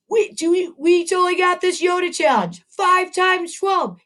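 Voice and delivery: rally the class, voice lifted high; teacher pumping up second graders for a Yoda challenge; excited. Real, not acted.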